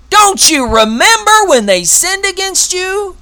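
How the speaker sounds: loud, high, relentless accusation; accuser's voice. Acted. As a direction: hammer God with charges like a prosecutor. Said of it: "He's got plenty of things to accuse us of".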